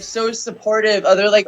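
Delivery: usual speaking voice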